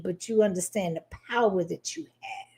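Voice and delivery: breathy whispered awe. Retold or imitated. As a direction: whisper it, half in wonder. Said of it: "looking at him like".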